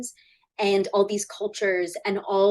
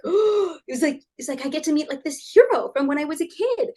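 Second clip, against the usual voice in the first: high starstruck "Oh", rushed; starstruck gasp; 6 semitones higher than usual. Retold or imitated. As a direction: fangirl meeting a childhood hero.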